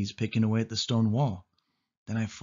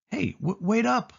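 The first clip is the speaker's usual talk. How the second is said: higher, anxious call after her; 12 semitones higher than usual. A narrator's reading. The boy calls to Sari ahead in the tunnel, anxious. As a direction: call after her as she pulls away. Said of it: "I cried".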